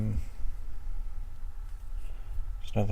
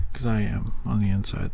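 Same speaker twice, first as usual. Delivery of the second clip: ordinary talk, nothing marked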